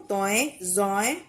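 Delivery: put-on name the letters توئیں and زوئیں clearly for children; letter name demo. Shown as a demonstration. Teacher showing kids how she says زوئیں. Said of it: "میں اس کو زوئیں بول دیتی ہوں".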